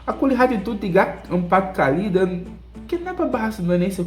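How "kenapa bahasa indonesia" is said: puzzled question. Retold or imitated. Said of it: "aku tanya".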